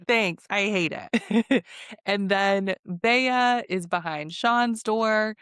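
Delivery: normal talking voice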